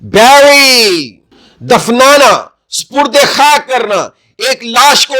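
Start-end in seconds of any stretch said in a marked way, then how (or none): 0.0-1.2 بیری stretched very slowly and loud; teacher modelling بیری; slow careful pronunciation. Shown as a demonstration. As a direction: draw out بیری for learners. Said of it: "اس کا تلفظ".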